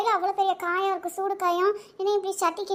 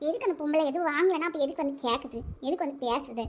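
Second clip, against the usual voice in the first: muttered grumble; 5 semitones lower, 6 dB quieter than usual. Acted. Grumbling after customer leaves.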